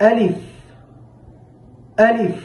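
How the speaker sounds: teacher demonstrating আলিফ; Arabic letter demo. Shown as a demonstration. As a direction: model আলিফ for students. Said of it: "যেমন".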